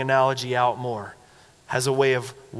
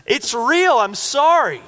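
brash, loud, high-pitched declaration; emphatic, unapologetic outburst, raised in pitch and volume; James MacDonald; 9 semitones higher, 6 dB louder, slower than usual. Retold or imitated. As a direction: imitate a bold preacher nailing his point. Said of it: "very brash, very outspoken, very bold guy".

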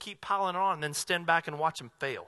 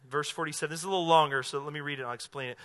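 ordinary talk

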